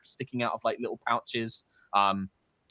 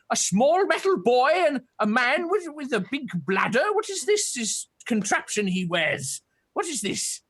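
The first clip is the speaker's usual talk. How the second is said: put-on high, curious old turtle creature voice; a wary hermit peering at strangers; old turtle man; 12 semitones higher than usual. GM voicing the old turtle hermit to the party.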